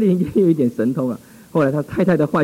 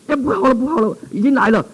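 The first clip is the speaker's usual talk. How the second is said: high, frightened cry of alarm; panicked man; 9 semitones higher than usual. Retold or imitated. Man seized by fear, crying the spirit has come. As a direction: voice the terrified man losing his nerve.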